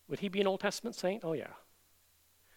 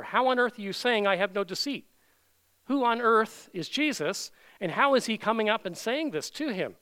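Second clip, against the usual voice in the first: louder, higher bewildered demand; incredulous questioning; 4 semitones higher, 6 dB louder than usual. Acted. Preacher voicing Nathanael puzzled by Jesus, incredulous. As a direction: voice a stunned man's disbelief.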